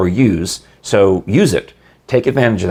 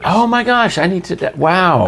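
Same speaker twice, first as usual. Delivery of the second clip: high, excited gasp of delight; delighted surprise; 10 semitones higher than usual. Real, not acted. Host reacting to the banjo audiobook, thrilled and surprised. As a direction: gush over the news, thrilled.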